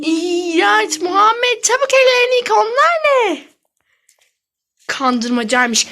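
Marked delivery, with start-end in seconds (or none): 0.1-3.4 disgusted shout. Real, not acted. Disgusted at her brother.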